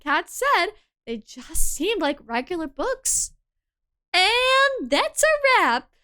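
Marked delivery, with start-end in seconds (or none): 4.1-5.9 much higher, slow and louder; sing-song high wrap-up; high slow sign-off. Acted. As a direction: stretch the line out high and proud. Character finishing the chore.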